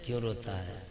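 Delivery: soft, low coaxing question; lightly acted grandpa, hushed and low; gentle maternal grandfather. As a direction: ask the crying grandchild quietly. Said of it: "نواسا رو دے تو نانا بھی کہتا ہے".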